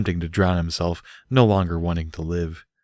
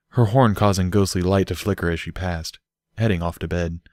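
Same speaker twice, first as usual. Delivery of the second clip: ordinary delivery, nothing marked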